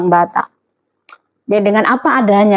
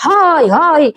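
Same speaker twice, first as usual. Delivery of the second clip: high, drawn-out hailing call; 6 semitones higher than usual. Acted. Preacher imitating a Batak greeting people, high hail. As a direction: call out higher, like a brash Batak. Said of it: "Lalu orang Batak".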